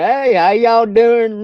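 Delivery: slow, high, loud Southern drawl imitation; mock Southern accent. Friend teasing him back in a put-on Southern accent. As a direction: ham up a Southern good-old-boy greeting. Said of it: "Southern accent".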